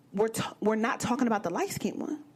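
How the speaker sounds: normal talking voice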